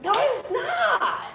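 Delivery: very high, slow mock whine with a laugh in it; mock wail. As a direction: wail it high like a hammed-up meltdown. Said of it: "And I'm like".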